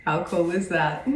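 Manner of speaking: usual speaking voice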